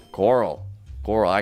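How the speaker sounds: low deep call. Pretend. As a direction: drop the voice way down for the name. Same name, deep contrasting take.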